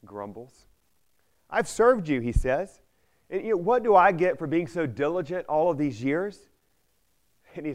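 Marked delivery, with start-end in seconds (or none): 1.5-2.2 higher, louder indignant grievance; older son complaining to his father, higher and louder; grumbling complaint. Retold or imitated. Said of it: "He complains, he grumbles". 3.3-6.5 higher, louder indignant grievance; older son complaining to his father, higher and louder; grumbling complaint. Retold or imitated. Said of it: "He complains, he grumbles".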